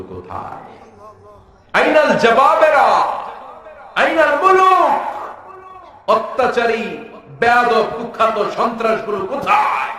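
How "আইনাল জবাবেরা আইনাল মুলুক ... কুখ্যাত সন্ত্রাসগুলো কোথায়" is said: thundering challenge. Acted. Preacher thundering questions. (জোরালো চিৎকারে প্রশ্ন)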